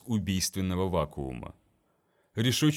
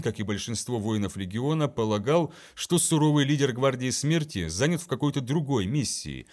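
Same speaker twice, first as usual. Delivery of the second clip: ordinary delivery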